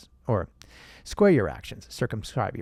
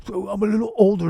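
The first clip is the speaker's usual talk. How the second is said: put-on higher-pitched old senator voice; Bernie Sanders; 6 semitones higher, 4 dB louder than usual. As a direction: play the ageing politician, hammed up. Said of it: "impression of Bernie Sanders".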